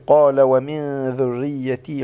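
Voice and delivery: قال ومن ذريتي run on without a pause; slow recited قال ومن ذريتي with no stop after قال; imitating the recitation without the stop; mimicking the mistake. Shown as a demonstration. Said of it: "قلت".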